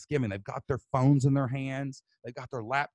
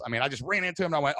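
ordinary talk

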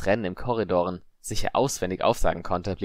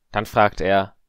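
ordinary delivery

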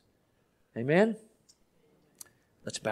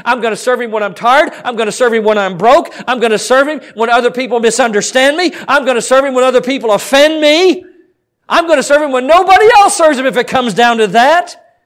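raised pitch, building with each vow; fervent pulpit declaration, growing louder; rousing preaching; 6 semitones higher, 5 dB louder than usual. Real, not acted. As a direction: drive the repeated vows upward in a rising list. Preaches at full force.

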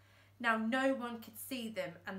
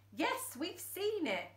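higher, slow, sarcastic; irritated; 8 semitones higher than usual. Acted. Fingers annoyed at Thumbs, sarcastic. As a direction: brush him off. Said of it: "said Fingers".

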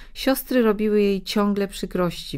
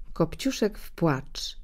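usual speaking voice

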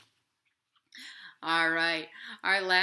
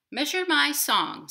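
louder, higher bossy bird; demanding nightingale; 10 semitones higher, 10 dB louder than usual. Acted. Nightingale bossily demanding the inchworm measure her song. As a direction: order it. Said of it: "said the nightingale".

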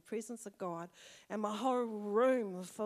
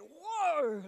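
sudden high loud whoa; startled whoa; 8 semitones higher, 7 dB louder than usual. Retold or imitated. Retelling waking from the dream, a startled loud whoa. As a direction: jolt awake with a big whoa.

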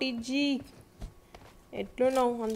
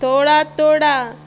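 Toda Toda said slow and much louder; drawn-out Hindi demo; 4 semitones higher, 12 dB louder, much slower than usual. Shown as a demonstration. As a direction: stretch out Toda Toda loudly, teasing. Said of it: "panipuri Hindi bro". (నెమ్మదిగా గట్టిగా సాగదీసి)

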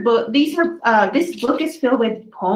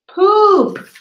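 much higher, louder burst on the last word; gleeful punchline; 9 semitones higher, 9 dB louder than usual. A narrator's reading. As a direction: shout the silly word to make the kids laugh. Funny final word for children.

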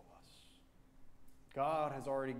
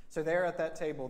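normal talking voice

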